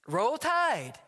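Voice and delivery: a bright, hearty "Roll Tide"; cheery fan greeting. Retold or imitated. Bus rider greets the pastor, hearty fan cheer. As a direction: greet him like a proud fan.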